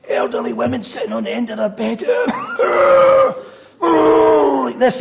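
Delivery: mimic old women. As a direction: act the coughing old women on their beds.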